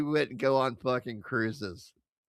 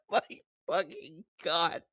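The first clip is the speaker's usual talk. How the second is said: normal talking voice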